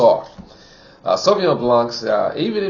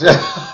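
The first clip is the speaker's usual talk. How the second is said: pleased laugh; 4 semitones higher, 5 dB louder than usual. Real, not acted. Pleased by the wine.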